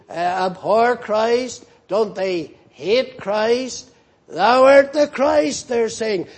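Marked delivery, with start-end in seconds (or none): 4.3-5.7 louder, higher mock cry of the devils. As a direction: call it out like the devils proclaiming him. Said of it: "they're crying it out".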